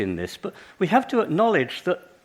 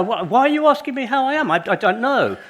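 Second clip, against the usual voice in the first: panicked inner babble; 2 semitones higher, 5 dB louder than usual. Retold or imitated. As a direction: spill it all out in a frantic laughing cascade. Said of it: "my head goes into a cascade, and I go".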